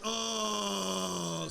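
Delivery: long, high 'oh' holler; crowd vowel sound. Retold or imitated. Comic imitating other audiences, long high 'oh'. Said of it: "Some are like".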